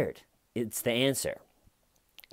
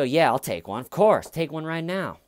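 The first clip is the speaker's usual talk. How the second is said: louder, higher, put-on willingness; eager agreement; 6 semitones higher, 5 dB louder than usual. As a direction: play at agreeing instantly for the camera. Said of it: "just sit there and go".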